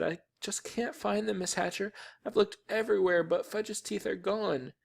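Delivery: put-on high, soft, tearful girl's voice; sniffling. A narrator's reading. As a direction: whimper it up high, upset. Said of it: "Sheila sniffled and said".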